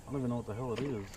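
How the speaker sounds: normal talking voice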